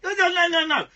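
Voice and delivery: loud protest. Real, not acted. Cutting off co-host.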